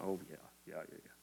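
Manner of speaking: very soft, low imitated muttering; wives' muttered agreement. Preacher mimicking the wives agreeing with him, muttered. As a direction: quietly imitate knowing agreement under the breath.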